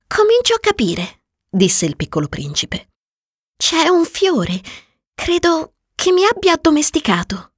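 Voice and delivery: put-on high, young boy's voice; little prince voice. Prince realises about flower.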